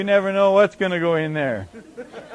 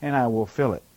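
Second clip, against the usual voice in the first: quieter, tender offer; soft voiced God; 5 semitones lower, 6 dB quieter than usual. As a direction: say it softly, as a gift. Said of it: "No, he's saying".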